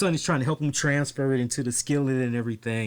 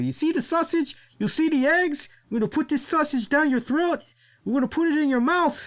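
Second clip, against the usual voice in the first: imitating La Parka; 13 semitones higher than usual. Mocking the wrestlers' sausage taunts on the cooking show, in a high voice. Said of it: "when they kept saying".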